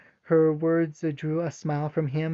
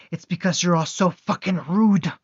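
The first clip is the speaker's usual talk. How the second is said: Naruto exploding at the soldiers, roaring; furious yell; 3 semitones higher, 8 dB louder than usual. Acted. Naruto raging at the soldiers, furious shout.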